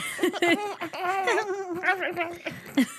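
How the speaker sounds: high creepy giggle; long, high laugh edging toward monster noises. Real, not acted. Host cracking up at own odd voice, creepy laughter. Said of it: "half a step away from monster sounds".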